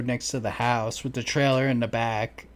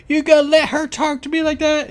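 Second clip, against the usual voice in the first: mocking shrill woman; 14 semitones higher, 7 dB louder than usual. Retelling neighbors' yard fight.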